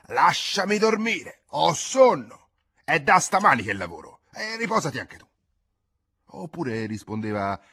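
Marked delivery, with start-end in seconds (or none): 0.1-5.3 husband shouting at his wife to let him sleep. Acted. Husband yells at his wife in bed, shouting. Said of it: "stringeva i pugni e gridava".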